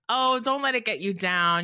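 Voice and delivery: the casual, carefree way people usually say it; light flip version. Retold or imitated. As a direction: toss it off lightly, like nothing matters. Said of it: "the lightness or even, like, the flip quality".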